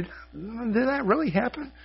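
high, slow voice of wondering disbelief. Retold or imitated. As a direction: ask it as if pinching yourself. Disbelief after the healing.

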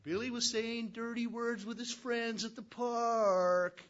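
sing-song snitching to Mommy; tattling little sister. Retold or imitated. Little sister telling on her brother to Mommy, whiny. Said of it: "She says".

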